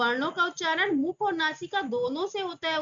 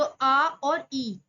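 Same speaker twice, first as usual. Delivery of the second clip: slow dictation; 4 dB louder, much slower than usual. Shown as a demonstration. Dictating the vowels slowly.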